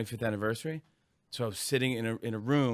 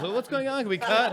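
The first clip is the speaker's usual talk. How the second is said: high, flustered questions; a lightly voiced panicking sitcom actor; mock panic; 10 semitones higher, 4 dB louder than usual. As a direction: panic on live TV.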